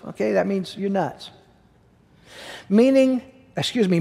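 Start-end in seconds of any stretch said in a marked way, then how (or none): none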